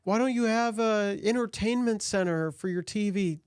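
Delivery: high, whiny mock girlfriend voice; nagging girlfriend impression; mocking nagging date. Acting out date's nagging question.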